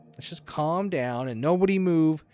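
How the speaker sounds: panicked. Acted. Standoff, everyone panicking.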